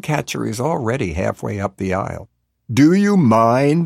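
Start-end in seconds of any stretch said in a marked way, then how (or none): 2.7-3.9 loud, slow, higher objection; pointed, drawn-out rebuke; annoyed. A narrator's reading. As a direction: drag it out with indignation. Annoyed man pushing past her.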